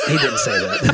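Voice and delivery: high, loud laughing protest. Real, not acted. Son denying friend's guess about dad, laughing. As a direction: protest through a burst of laughter.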